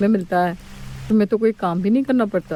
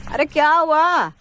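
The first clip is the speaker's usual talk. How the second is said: soothing; 7 semitones higher than usual. Acted. Mother comforts crying baby.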